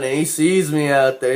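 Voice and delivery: ordinary talk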